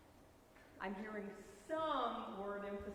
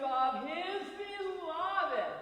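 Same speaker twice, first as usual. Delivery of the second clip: "of his beloved" swelling high and loud, drawn out; emphatic stress; 7 semitones higher, 6 dB louder, much slower than usual. Shown as a demonstration. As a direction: stretch "beloved" with heavy weight on its middle syllable. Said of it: "emphasis that you need".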